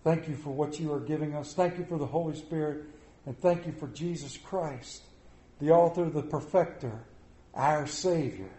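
soft, hushed prayer voice. Acted. Pastor praying over the church, hushed and reverent. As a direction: lead the congregation in quiet prayer. Opening prayer before sermon.

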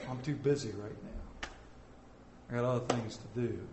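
low, soft brush-off; muted excuse. Acted. Person answering God's call, quiet reluctance. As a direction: excuse yourself under your breath.

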